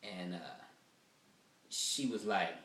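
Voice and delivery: usual speaking voice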